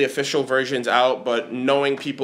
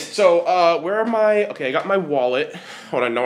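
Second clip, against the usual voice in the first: ordinary delivery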